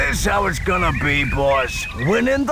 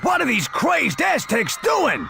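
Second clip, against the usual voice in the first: high, furious shout; angry shout; 8 semitones higher than usual. Acted. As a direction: yell it in outrage. God furious at attackers.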